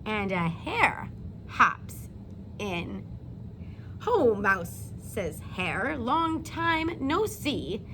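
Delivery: put-on slow, lilting read-aloud to young children; storybook reading. A narrator's reading. Teacher reading a bedtime story to her class, gently lilting. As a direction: read it like story time for little kids.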